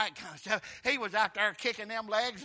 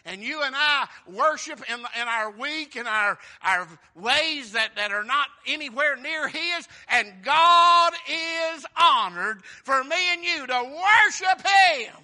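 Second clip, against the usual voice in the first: building from eager to loud, shouted preaching; loud, higher and higher, shouting sermon climax; preaching at full force; 6 semitones higher, 15 dB louder, slower than usual. Acted. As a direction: whip the congregation up.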